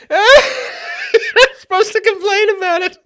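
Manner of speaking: high, slow, squeezed out between sobs of laughter; crying with laughter. Real, not acted. Host collapsing in laughter, crying laughing. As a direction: wheeze the words.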